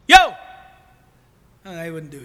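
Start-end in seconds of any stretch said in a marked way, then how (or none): 0.1-0.3 short loud call across a crowd. Retold or imitated. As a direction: shout Yo to get attention. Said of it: "And that pastor goes".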